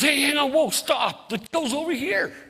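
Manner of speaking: high, loud acted halt; alarmed calling out. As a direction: call after someone walking off. Store manager calls out to stop him.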